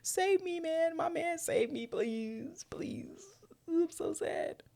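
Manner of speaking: high mock-pitiful plea, fading to a whisper; damsel in distress. Narrator mocking Zoe clinging to Vince, high whiny plea. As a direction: ham up a helpless damsel begging. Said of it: "It's given, like".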